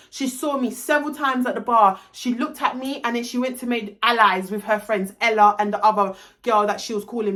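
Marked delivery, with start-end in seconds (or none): none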